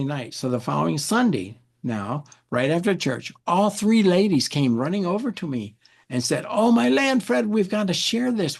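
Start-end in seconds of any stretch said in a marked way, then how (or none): 6.5-8.6 high burst of amazement; amazed. Retold or imitated. Church ladies rushing up to Fred after service, high amazed. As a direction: exclaim it like you can't contain it. Ladies came running over.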